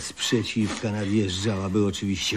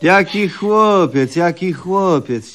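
mock indignation; 7 semitones higher, 4 dB louder, slower than usual. Indignant at being called boy.

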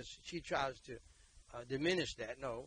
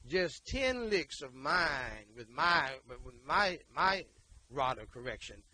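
louder and very slow, ten hammered; slow loud boast; 8 dB louder, slower than usual. Real, not acted. Preacher boasting about whipping to the congregation, loud and slow. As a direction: drag it out, relishing every lick.

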